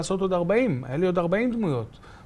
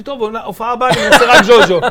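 laughing surrender; 6 semitones higher, 13 dB louder than usual. Retold or imitated. Giving in, laughing.

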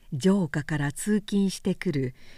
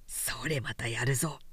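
whispered with a smirk; sly whisper; 7 semitones lower, 8 dB quieter than usual. A narrator's reading. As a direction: breathe it under your breath, gleeful. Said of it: "とニヤニヤしながら".

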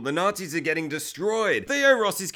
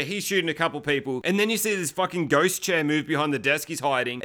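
normal talking voice

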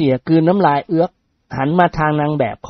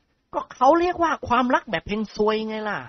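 character voice; 7 semitones higher, 4 dB quieter than usual. Acted. Character line, raised pitch.

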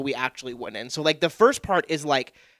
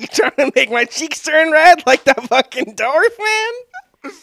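mock whiny; 11 semitones higher, 9 dB louder, slower than usual.

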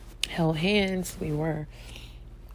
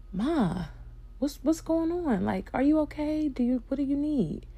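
high-pitched, anxious check on Mom; worried questioning; 9 semitones higher than usual. Retold or imitated. Arriving at Mom's hospital bedside, worried. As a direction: retell it high, full of concern.

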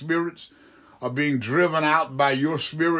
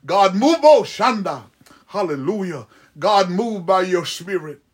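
praying in tongues; 2 semitones higher, 4 dB louder than usual. Real, not acted. Preacher crying to God to move, praying in tongues. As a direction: break into ecstatic, forceful prayer syllables.

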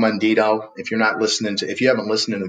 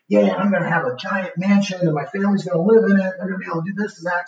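lightly acted eager dreamer, higher pitch; imitating excited attendee; 9 semitones higher, faster than usual. Seminar guest tells the host his dream, mock excited. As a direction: gush in a raised voice, mimicking someone's big plans.

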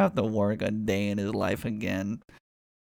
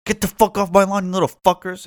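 loud yell off the porch; thirty-year-old yelling at kids on his lawn; 6 semitones higher, 13 dB louder than usual. Retold or imitated. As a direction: bark at the kids to get off. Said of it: "who was like".

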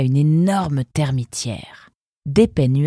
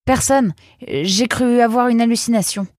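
high-pitched, flustered excuse; hasty self-justification, pitched well up; flustered reply; 9 semitones higher, 3 dB louder than usual. A narrator's reading. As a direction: answer the guard in a high, caught-out voice. Prisoner answering the shouting guard.

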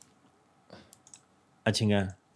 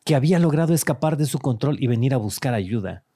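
dramatic storytelling read; story narration. Acted. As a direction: narrate it like a dark tale. Reads AI story aloud.

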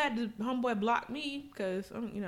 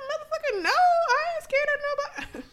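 high, loud defiant comeback; defiant retort; 4 dB louder than usual. Retold or imitated. Telling the cop she fears nobody, high defiant shout. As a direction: throw it back at him, fearless.

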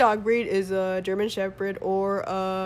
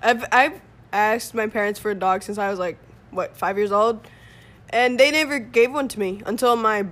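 ordinary talk

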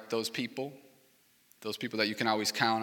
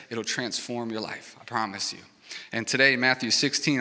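ordinary talk

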